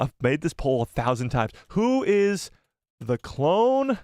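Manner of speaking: ordinary talk, nothing marked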